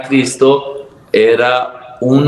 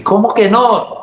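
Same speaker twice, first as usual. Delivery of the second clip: higher, puzzled question; surprised official; 9 semitones higher than usual. Retold or imitated. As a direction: voice the confused lady. Imita a la señora sorprendida. (señora sorprendida, más aguda)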